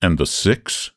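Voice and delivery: a touch of deadpan; dry. A narrator's reading. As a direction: ask it dryly, with little energy. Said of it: "he asked dryly".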